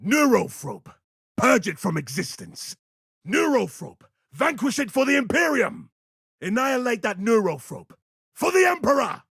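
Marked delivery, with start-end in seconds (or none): 3.3-9.2 high-pitched rallying cry; battle cry. Acted.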